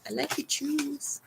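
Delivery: light imitation of a comic catchphrase; Cheech Marin. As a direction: tease like a stoner comedy line. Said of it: "I used to do that to people".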